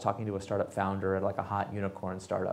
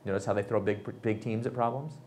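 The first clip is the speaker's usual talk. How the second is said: ordinary delivery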